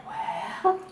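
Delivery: breathy whispered aside; a hushed, doubting whisper to herself; whispering the thought under her breath. Retold or imitated. Private doubtful thought retold.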